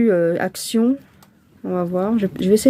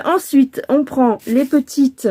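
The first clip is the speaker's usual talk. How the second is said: ordinary talk, nothing marked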